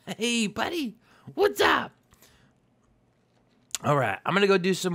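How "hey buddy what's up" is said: squeaky pet voice; high-pitched talk to a pet; high baby voice. Acted. Greeting horse in high voice.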